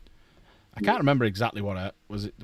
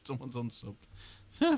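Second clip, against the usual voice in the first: usual speaking voice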